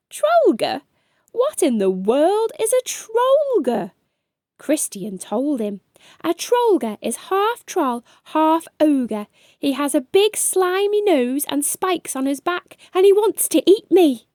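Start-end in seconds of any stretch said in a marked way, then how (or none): 0.1-3.9 leprechaun voice, higher, repeating the odd word in disbelief; amused disbelief. Acted. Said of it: "The leprechaun laughed and asked". 6.2-14.3 put-on higher young boy's voice, growing louder toward the end; a scared kid explaining the monster; boy describing a monster.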